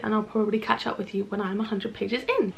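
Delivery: ordinary talk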